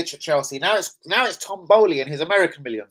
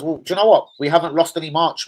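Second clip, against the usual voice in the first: usual speaking voice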